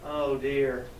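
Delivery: low, subdued dismay; regretful. A narrator's reading. Animals realise they misjudged the pig, regretful. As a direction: let it sink down in quiet regret.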